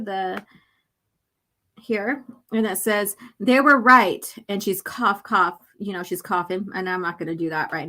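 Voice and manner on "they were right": the same confession suddenly blurted loud; reading Joran's line aloud, loud and sudden; loud blurted admission. Acted. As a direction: punch it out loud. Bold caption line read out.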